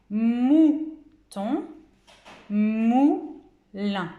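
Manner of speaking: "mouton, moulin" slowly split, with a long, stressed "mou"; découpage syllabique. Shown as a demonstration. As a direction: stretch "mou", pause, then finish "mouton" and "moulin". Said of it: "puisqu'on entend". (« mouton, moulin » découpés, « mou » très allongé)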